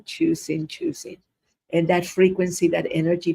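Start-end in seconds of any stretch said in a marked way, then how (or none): 0.0-1.2 each choosing lifted much higher and fading softer; sing-song choosing, choosing, trailing off; lilting repeat. Shown as a demonstration. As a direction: float the repeats up and away. Repeating choosing, rising away.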